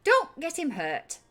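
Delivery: high, loud character voice; high-pitched, forceful caution; warning Shrilt. Acted. Shrilt warns Mishak.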